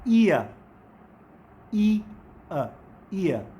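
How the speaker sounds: ईयर gliding ee into a schwa; teacher modelling the ear sound; diphthong demo. Shown as a demonstration. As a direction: stretch ईयर from ee into uh. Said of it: "किस तरह से pronounce करेंगे".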